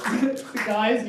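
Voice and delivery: ordinary talk, nothing marked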